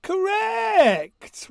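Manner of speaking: loud, high, delighted "Correct!"; excited shout. Real, not acted. Host confirms a right answer.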